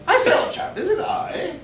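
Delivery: very high hammed-up British toff; exaggerated high-pitched British punchline; British accent. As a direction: squeal it in a comic British accent. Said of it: "with his British accent".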